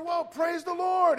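high, loud, slow praise the Lord; exultant praise. Retold or imitated. The man rejoicing at news the cancer is gone, slow loud high praise. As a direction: drag it out in delight. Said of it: "I said".

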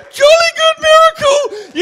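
loud, high mock British exclamation; hammed-up posh English praise; mock British cry; British accent. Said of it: "you wouldn't go".

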